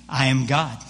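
low, measured declaration as God; booming voice of God. Acted. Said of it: "this loud booming voice".

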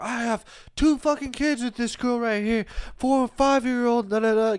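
hammed-up drunk dad bragging about his kids; high mock drunk rant. Imitating drunk guy's rant.

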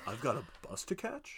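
soft, "catch" lifted like a question; quiet deadpan with an upturned ending; mock uptalk. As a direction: make a question of the exit line, rising on "catch". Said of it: "just like my sentence".

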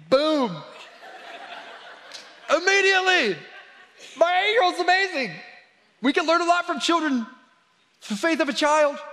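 very high-pitched, exultant preaching; thrilled exclamation. Real, not acted. Preacher telling of daughter's answered prayer, thrilled and high. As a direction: ring it out high, amazed and proud.

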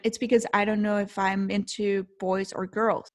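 ordinary talk

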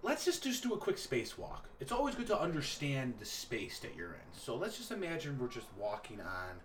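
ordinary talk

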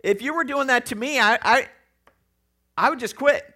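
a hint of a stern youth leader; light mock lecture to rowdy boys; lecturing. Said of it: "he lectures them".

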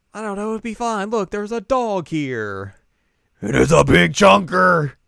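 high, delighted cooing; cheerful excitement. Retold or imitated. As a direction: gush over the big dog. Cuckoo excited about the dog.

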